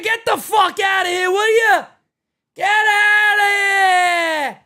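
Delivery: mock manager yelling, last words dragged out; acted angry yell. Streamer voices the manager at the umpire, mock-angry. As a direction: imitate a heated manager, stretching the final order. Voicing the furious manager arguing.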